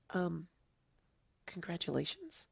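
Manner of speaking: soft, high, unsure little question; timid pony voice; voiced Fluttershy, hesitant and soft. A narrator's reading. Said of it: "Fluttershy said hesitantly".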